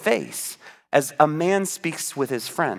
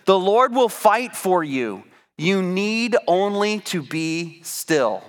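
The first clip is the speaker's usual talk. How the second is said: slow emphatic reading; slower than usual. Shown as a demonstration. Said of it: "this verse that I've been sharing".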